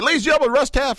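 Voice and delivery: high, loud emcee introduction; announcer voice. Retold or imitated. Host imitates MC announcement.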